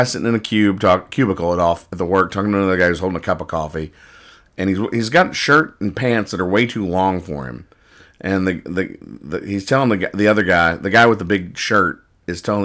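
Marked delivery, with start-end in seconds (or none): none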